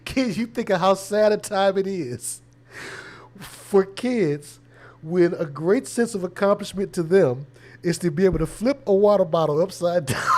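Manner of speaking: high, loud Boston rant while laughing; laughing Bill Burr impression. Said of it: "He was like".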